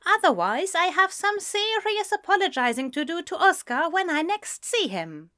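high-pitched reasoning in character; worried, proper explanation; earnest, prim friend. A narrator's reading.

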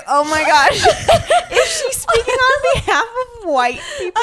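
high, laughing disbelief; shocked. Retold or imitated. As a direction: gasp it out through laughter. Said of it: "and I was like".

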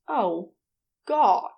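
usual speaking voice

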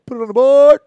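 big booming announcer call; announcer home run call. Retold or imitated. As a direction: belt it out like a radio play-by-play man. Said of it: "one of my favorite guys doing baseball is".

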